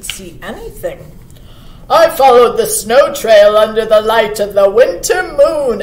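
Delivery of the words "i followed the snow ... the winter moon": loud, firm deer voice; storybook buck. A narrator's reading. Buck reporting on his night watch, loud and firm. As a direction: declare it loudly as a buck. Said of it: "answered the buck".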